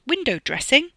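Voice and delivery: high, loud challenging question; demanding. A narrator's reading. Wes questions his role in the plan, demanding. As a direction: throw it out as an indignant question. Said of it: "Wes demanded".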